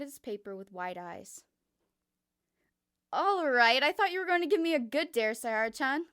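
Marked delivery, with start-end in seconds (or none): 3.1-6.0 louder, higher and mocking; loud playful jab at Saihara; loud teasing Kokichi. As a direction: tease him loudly for the weak dare.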